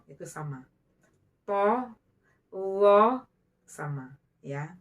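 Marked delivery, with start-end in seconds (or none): 1.5-3.2 "To, lo" loud, mid-tongue raised to the palate; loud, thickened t and l in "To, lo"; thick, heavy consonants. Shown as a demonstration. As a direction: push out "To, lo" full and heavy. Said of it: "Tengah-tengah lidah diangkat".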